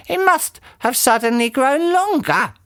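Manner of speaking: put-on squeaky puzzled old lady; Missus Twit voice. A narrator's reading. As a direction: play her as a high, befuddled old wife.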